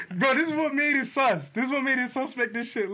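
high mock voice of the girl; her excuse. Imitating his passenger's excuse in a high voice. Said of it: "she said".

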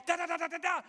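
high, rapid nonsense barrage; hammed-up drill sergeant rant; mock yelling. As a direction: imitate a sergeant yelling gibberish. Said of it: "he's yelling, yelling, yelling".

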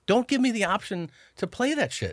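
high-pitched exasperated protest; exasperated plea. Retold or imitated. Host begging his crew not to tempt him, exasperated. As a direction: protest as if helpless against temptation.

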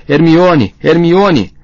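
loud high repeated cries; desperate yelling. A narrator's reading. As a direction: scream her name over and over. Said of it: "Para de berrar". (Rony gritando pela amiga)

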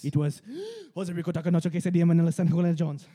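gasp, then belt the long name out loud; dramatic reveal. Acted. Friends joking about a soap opera, dramatic loud reveal.

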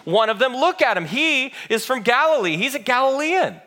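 high-pitched, loud insistence; insistent accusation. A narrator's reading. Bystander accusing Peter of following Jesus, insistent. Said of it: "someone else insisted".

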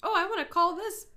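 ordinary talk, nothing marked

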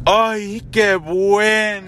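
high, loud, drawn-out fake joy; exaggerated sarcastic rejoicing; mock delight. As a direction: gush as if hugely relieved, hammed up. Mock-delighted reply to backhanded compliment.

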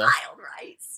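whispering. Real, not acted. Playful ASMR-style aside.

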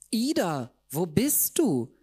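high slow call into the room; high drawn-out call. Acted. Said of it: "rief ich in das Zimmer".

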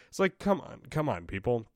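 ordinary talk, nothing marked

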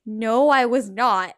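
loud, slow "No, I was not"; "No, I was not" drawn out and punched for comic emphasis; emphatic denial. Retold or imitated. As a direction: land every word of "No, I was not" firmly and slowly. Emphatic answer to cashier, retold.

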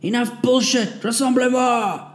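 high barked command; shouted order. A narrator's reading. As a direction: bark it at the squad. Sergeant barking orders.

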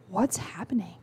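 put-on breathless whisper of a confused child; stunned whisper. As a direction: whisper it, frozen in shock. Kid stunned as milk spills.

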